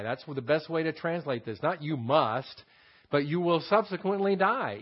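ordinary talk

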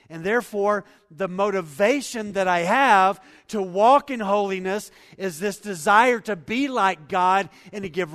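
loud, high, impassioned pulpit voice; preaching at full force. Acted. Pastor telling the room nothing will last, impassioned preaching.

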